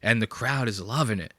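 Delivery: ordinary delivery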